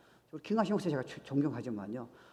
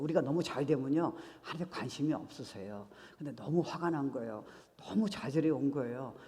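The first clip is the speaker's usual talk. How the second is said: usual speaking voice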